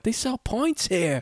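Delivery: high, slow mock British accent. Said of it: "Remember in The Hobbit".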